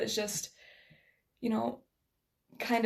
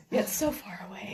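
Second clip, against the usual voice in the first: mock-dramatic whisper. Real, not acted. To her friend, joking about Canada, in a dramatic whisper. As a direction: breathe it like a secret aside.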